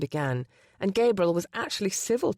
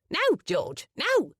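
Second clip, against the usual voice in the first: higher squawked parrot call; parrot mimicry; 10 semitones higher, slower than usual. Parrot Delilah mimicking a command, high squawk. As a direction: squawk it like a bird.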